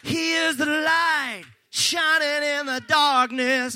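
ordinary talk

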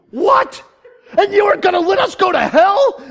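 high, loud mock-outraged shout; righteous indignation. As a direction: play a furious wife yelling in disbelief. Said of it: "she was mad. Righteous indignation".

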